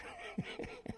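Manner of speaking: quick unvoiced laugh burst; real laughter at the prank; airy chuckle; breathy laugh. Real, not acted. Speaker laughs at own prank.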